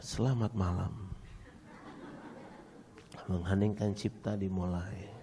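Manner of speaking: low, slow ceremonial announcement; hushed, drawn-out formality; mock solemn announcer. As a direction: intone it like opening a minute's silence. Mimics solemn ceremony after silent audience.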